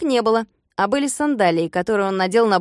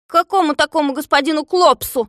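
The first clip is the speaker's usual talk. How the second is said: high, loud, baffled question; raised, indignant query; questioning captive; 6 semitones higher, 6 dB louder than usual. A narrator's reading. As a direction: demand to know, puzzled and affronted. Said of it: "спросил Незнайка".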